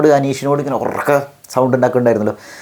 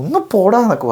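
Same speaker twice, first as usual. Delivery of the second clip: much higher, exasperated dismissal; irritated brush-off; 10 semitones higher than usual. Acted. Speaker with a headache sending a pesterer away, irritated brush-off. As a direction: act out shooing away a pest. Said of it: "വല്ലാണ്ട് ചൊറിഞ്ഞിട്ടുണ്ടെങ്കിൽ ഞാൻ ചിലപ്പോൾ".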